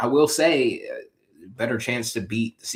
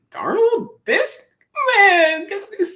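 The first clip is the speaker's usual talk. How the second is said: very high, loud mock exasperation; Mahomes; 7 dB louder, slower than usual. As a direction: squeal it like a stunned star quarterback. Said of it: "Mahomes is like".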